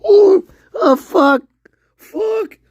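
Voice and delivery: very high, hammed-up wail; mock crying. Streamer making fun of people who cry, mock crying. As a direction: fake a loud, high-pitched sob.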